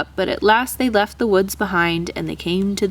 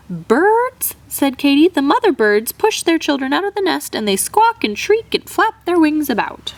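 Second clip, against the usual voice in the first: much higher, animated mother voice; excited; 7 semitones higher than usual. Acted. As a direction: tell it in high alarm. Katy describes birds, excited.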